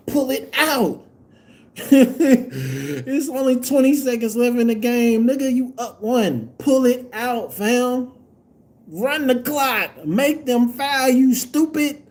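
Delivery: high, insistent rant; worked-up fan telling a player off; exasperated scolding. Real, not acted. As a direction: drum the instruction into the player. Fan scolding player over blunder.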